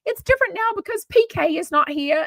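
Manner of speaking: high, prissy mock of Dorit's voice. Vlogger mocks Dorit hosting parties, high prissy impression. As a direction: lift way up and play her airy and precious. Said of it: "Dorit's like".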